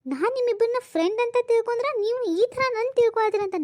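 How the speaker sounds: high, heated rebuke; indignant scolding. Acted. Woman scolding two coworkers for proposing, indignant. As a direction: tell them off, outraged.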